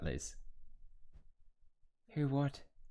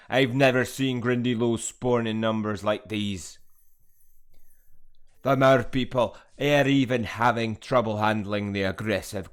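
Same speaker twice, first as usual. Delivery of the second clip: much louder, hearty put-on character accent; 10 dB louder, slower than usual. Gamer voicing a Hogwarts character, loud hearty accent. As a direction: boom it out like a weathered groundskeeper.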